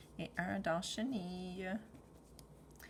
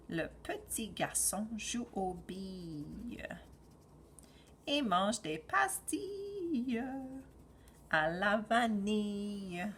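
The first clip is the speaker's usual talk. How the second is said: put-on reading to a toddler. Teacher reads the story to children.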